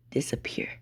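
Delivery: very soft, whispered order; whispered command. Acted. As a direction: whisper it like casting a spell. Deku makes the flame vanish.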